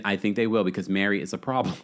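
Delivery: ordinary talk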